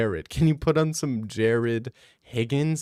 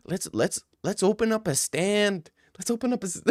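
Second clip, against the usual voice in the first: higher, excited buddy voice throughout; a higher, hammed-up Mexican dude impression; mock Mexican bro; 8 semitones higher than usual. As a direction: hype a friend up to go get tacos. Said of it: "You're hearing them talk like".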